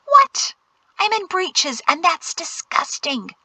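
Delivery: high, squeaky indignation; high-pitched scandalized whine; appalled. A narrator's reading. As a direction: squeal in disgust, then drag out the last words. Jatesy appalled at the suggestion.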